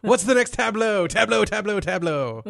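lightly mocking tableau, rising then fading; quoting Dexter. Quoting Dexter's overused word, mock-dramatic. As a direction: toss tableau around like a catchphrase. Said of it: "To quote".

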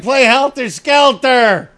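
put-on loud, slow, drunken rock-fan yell; rowdy shout. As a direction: bellow it at the stage like a wasted fan. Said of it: "You're like".

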